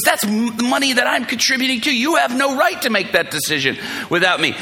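high-pitched imitated tirade; furious wife. Wife asserting her say over shared money, imitated furious scolding. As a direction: scold indignantly as the outraged wife. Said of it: "Just rips into me".

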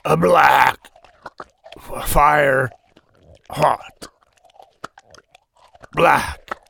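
strangled, dry corpse croak. Acted. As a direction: choke it out, loud and dry. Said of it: "in a strangled, dry voice".

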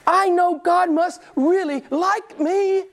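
high, slow, self-satisfied voice; mock the pious man; smug churchgoer. Preacher mocking a self-righteous churchgoer, smug and slow.

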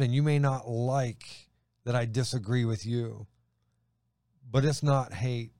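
ordinary delivery

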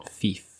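Fife said softly as "feef"; soft, low Fife with an "ee" vowel; mispronunciation demo. Shown as a demonstration. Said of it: "You don't, it's f".

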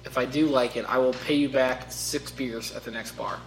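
usual speaking voice

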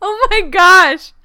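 high, slow laughing squeal; delighted gush. Real, not acted. Reacting to Too Cute.

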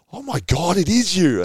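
high, loud astonished outburst; sudden stunned recognition, pitch leaping right up; amazed realisation. Retold or imitated. Young teammate finally recognising him in the photo, amazed.

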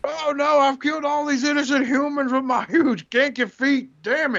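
high, hammed-up distraught giant; mock dismayed robot. Robot laments destruction.

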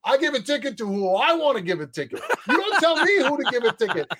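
cop snapping back. Retold or imitated. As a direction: play the indignant traffic cop. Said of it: "the cop just looks over and goes".

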